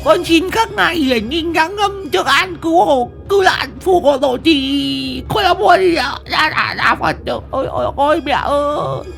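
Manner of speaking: high sobbing panic, stumbling through prayers; terrified crying. A narrator's reading. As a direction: cry out to mother while praying in terror. Terrified wife praying, crying.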